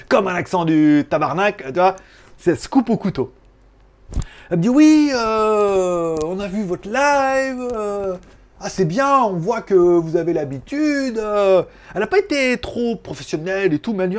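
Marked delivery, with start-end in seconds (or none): 4.7-11.6 voix haut perchée, mots étirés; chargée de com imitée. Retold or imitated. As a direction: imite une interlocutrice, traîne sur les « euh ». Imite la chargée de com au téléphone. (imitation traînante et aiguë de chargée de com)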